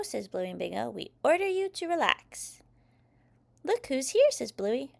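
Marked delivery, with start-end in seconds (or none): 0.9-2.6 put-on high, louder kid order; children's playful command. A narrator's reading. Kids tell robot to rest, high commanding voice. 3.6-4.3 high, loud announcing; excited child. A narrator's reading. Child presents mummy robot, high excited voice.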